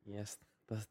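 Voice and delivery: breathy whispered aside; whispering. Real, not acted. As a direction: drop to a whisper.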